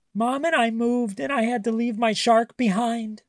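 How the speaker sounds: put-on high, lightly tearful little girl; sobbing. A narrator's reading. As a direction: tell it through mild sobs. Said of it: "she sobbed".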